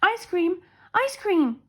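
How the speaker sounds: ordinary delivery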